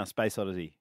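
flat, droning announcer read; monotone voice. Pretend. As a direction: read it with no tune at all. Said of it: "How many more things can I say in a monotone voice?".

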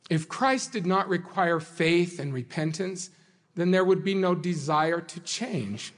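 ordinary delivery

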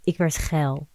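usual speaking voice

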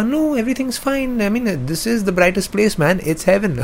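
high, breezy mock Saint Peter voice; cheery Saint Peter. Saint Peter tells blind Simon heaven is bright, high breezy voice. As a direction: play Peter brushing off a silly worry, lightly. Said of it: "Peter is like".

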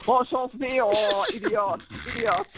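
high, mocking French-accented jeer; mock French onlookers. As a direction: jeer at the swimmer like a French bystander. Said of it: "the French on the side of the river going".

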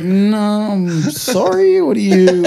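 high, drawn-out disguised voice; changed voice. Retold or imitated. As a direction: play innocent in a high put-on voice. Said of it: "had to change voices three times".

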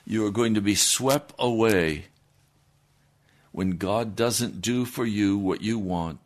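normal talking voice

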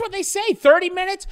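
high, loud mocking repeat; mocking echo. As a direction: throw his answer back, amused. Mocking the answer.

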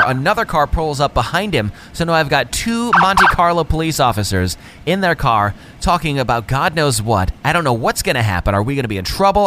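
ordinary delivery

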